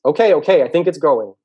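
loud, high, urgent interruption. Acted. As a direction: cut over the noise, loud and high.

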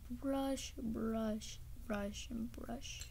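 slow, trailing into a whisper; slow fading whisper. Acted. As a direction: let it fade away. Brushing dog, trailing off.

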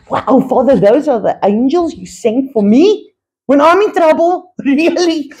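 high, bubbling with laughing wonder; thrilled prayer. Retold or imitated. Thanking God for protecting angels, high delighted wonder. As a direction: gush to God in delighted disbelief.

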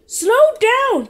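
high, loud call to slow down; mock urgent shout; shouted warning. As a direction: yell after the speeding bike.

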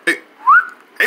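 ordinary talk

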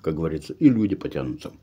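talking while chewing food; speaking through a mouthful of bread; mouth full. Real, not acted. Seen 2: Takes bites just before; cheeks bulge while speaking, sandwich in hand.

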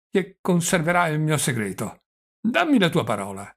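put-on loud, lifted old man's voice; doddering old prince, triumphant. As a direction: confide with gleeful self-importance. (vecchio principe trionfante e confidenziale)

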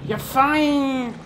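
high, long-held praise; pet praise. Real, not acted. Player rewarding the pet, doting praise.

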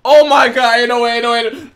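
ordinary delivery

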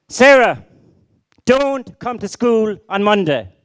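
loud, high, slow telling-off; teacher shouting. Retold or imitated. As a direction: bellow the warning at the girl. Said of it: "because of the teacher shouting".

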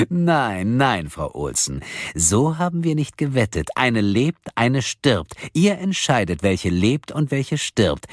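swooping, sing-song mockery; metallic villain. A narrator's reading. Kidnapper explaining deadly rules to victims, gleefully taunting. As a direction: savour the cruel game, stretching phrases. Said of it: "lachte die metallische Stimme".

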